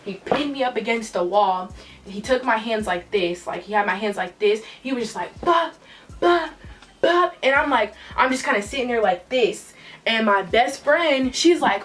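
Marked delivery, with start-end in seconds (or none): 5.4-7.4 three high, spaced bops; imitated blows. He pinned her against the wall, high hit noises. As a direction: pop out each hit as a noise. Imitating his repeated hits.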